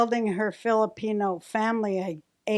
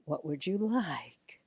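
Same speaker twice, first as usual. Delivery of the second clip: sweet whisper; 3 dB quieter, much slower than usual. Retold or imitated. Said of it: "The sweet sayings".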